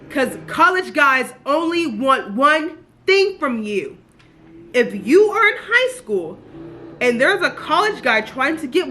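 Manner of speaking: urgent warning. Real, not acted. Book reviewer warning high school girls, loud and slow. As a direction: spell it out loud and slow like a big-sister alarm.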